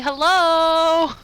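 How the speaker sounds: high, loud, drawn-out call of hello; over-the-top hello. Acted. Player calls through the door to make presence known, over-the-top hello. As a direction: sing out an exaggerated, long greeting. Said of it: "also going".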